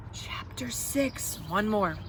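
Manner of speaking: plain reading voice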